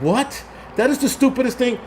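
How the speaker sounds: high-pitched retold disbelief; incredulous. Host recalling hearing about time blindness, incredulous. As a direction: react in disbelief, voice jumping up.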